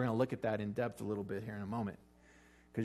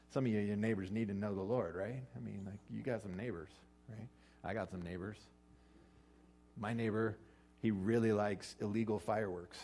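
ordinary talk, nothing marked

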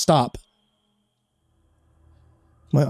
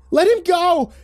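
yelling; slower than usual. Acted. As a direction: shout at the monster. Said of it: "yelled Emily".

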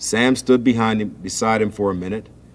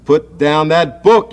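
higher, louder, slow yell; George yelling; 6 semitones higher, 6 dB louder, much slower than usual. A narrator's reading. Big brother George yells at Sam, raised voice. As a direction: snap at him to drop the book. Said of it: "yelled George".

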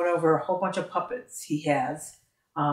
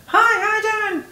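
very high, louder put-on voice; switched-up voice; 6 dB louder than usual. Shown as a demonstration. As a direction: pitch it way up for a silly greeting. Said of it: "if you switch your voice around".

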